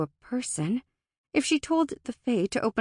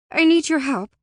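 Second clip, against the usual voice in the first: urgent, higher and louder plea; breathless; 8 semitones higher, 8 dB louder than usual. A narrator's reading. Alora begs the Fae after sprinting over, breathless. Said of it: "she was breathless, but not too breathless to speak".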